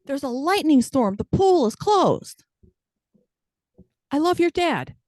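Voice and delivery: high, exaggerated talking-to-kids voice. Parent lying to little kids, high mock-sincere voice. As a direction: pitch it up high, overselling each fib.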